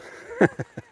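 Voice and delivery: high chuckling laugh; short burst of high laughter. Real, not acted. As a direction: crack up at your own shouting. Laughs at own retold shouting.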